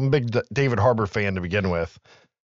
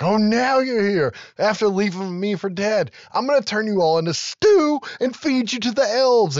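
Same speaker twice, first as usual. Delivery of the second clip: going off on reindeer; 10 semitones higher than usual. Retold or imitated. Santa ranting at reindeer who left him, higher mock fury. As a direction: scold them like a furious boss. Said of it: "he starts going off on the reindeer".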